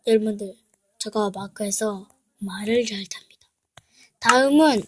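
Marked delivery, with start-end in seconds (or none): 1.0-3.5 whispered aside to viewers; soft unvoiced brag. Real, not acted. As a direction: whisper it like a secret.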